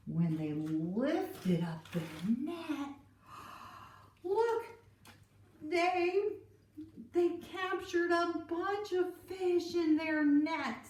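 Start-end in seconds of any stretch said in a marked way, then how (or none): 3.3-3.8 gasp. Acted. Amazed at the full net. 4.2-10.9 high, excited storyteller gasp of wonder; higher, eager exclamation; amazed. Acted. Disciples amazed at the catch.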